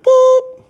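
high beep mimicking an answering machine.

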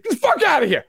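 high mock scoff; dismissive fans. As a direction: brush them off, mock-annoyed. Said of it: "Chiefs and Bills fans are like".

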